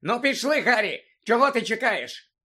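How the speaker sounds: impatient urging. A narrator's reading. Old woman hurrying the boy home, impatient. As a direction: chivvy the listener along.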